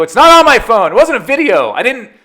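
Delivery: loud, high-pitched protest; mock-outraged denial; retold exasperation. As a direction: act out an exasperated son defending himself. Retold exasperated argument with dad.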